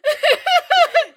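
a big burst of laughter; real loud laugh. Real, not acted. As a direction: laugh out loud, high and sudden.

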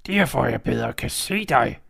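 a predator pretending to be granny; wolf in disguise. Wolf answers Red.

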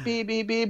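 high monotone beeps; high, even run of beeps; imitated beeping. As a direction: mimic the little car beeping back. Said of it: "then we hear".

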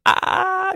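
high, loud mock crying wail; short high crying yelp in mockery. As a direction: wail it like a fake sob. Mocking sister's crying.

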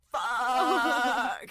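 high mock yell of frustration; mock scream. Imitating Jack screaming alone in the car. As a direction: yell it like a man losing it.

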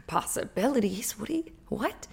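can't believe what she's hearing, whispered; stunned disbelief. Retold or imitated. Karen to the counselor, in stunned whispered disbelief.

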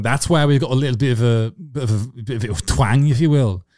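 hammed-up Scottish lilt posing as Irish; fake Scottish accent. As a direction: bloke faking a Scottish accent to a girl. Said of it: "That's a Scottish accent".

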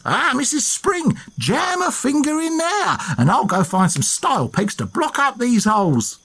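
a cheeky Essex rogue selling a trick, pitched high; Essex accent. Retold or imitated. Dick Turpin conning the landlady, high hammed-up Essex accent. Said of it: "I'm doing an Essex accent".

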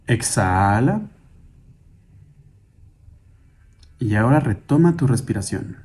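calm, measured meditation guide with long pauses; guided meditation. Acted. Guide asking listeners to sit, breathe and hear their surroundings, guided meditation. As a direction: lead them gently through breathing and listening.

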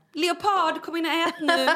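high, loud call to dinner; parent calling a child. Pretend. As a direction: call the kid in from outside. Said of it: "då kommer ju folk säga det". (ropar in barnet till maten)

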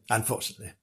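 mock sorrow, almost under the breath; soft mock-sad aside. Storyteller to children, softly mock-regretful repeat. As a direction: drop to a hushed, rueful repeat.

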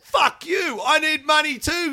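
high-pitched indignant shout; exasperated, hollered protest; retold angry comeback. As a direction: yell back at the boss in re-enactment. Retold shouting match with boss.

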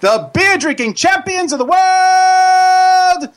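big ringmaster announcement. Acted.

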